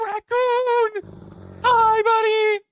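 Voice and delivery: very high, loud delighted squeal; excited. Real, not acted. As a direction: gasp and squeal with joy. Gasps at the raccoon.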